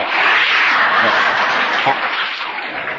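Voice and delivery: crowd laughing; audience laughter. Real, not acted. Audience laughing at the honeymoon punchline. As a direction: room laughs at the drawers joke.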